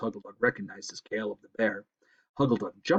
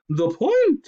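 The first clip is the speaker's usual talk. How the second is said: high, loud snap; sharp owlish snap; snapped; 13 semitones higher, 8 dB louder than usual. Acted. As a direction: bite off the words like a cross owl. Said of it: "snapped the owl".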